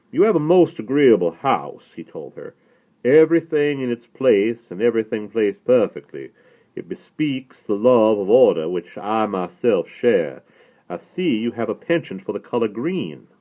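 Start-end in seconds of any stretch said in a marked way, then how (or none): 0.1-1.9 higher, louder Southern rabbi's voice; courtly Southern compliment to the hostess; mellifluous Southern accent. A narrator's reading. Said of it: "From the mellifluous southern accent". 3.0-13.3 higher, louder Southern rabbi's voice; courtly Southern compliment to the hostess; mellifluous Southern accent. A narrator's reading. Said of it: "From the mellifluous southern accent".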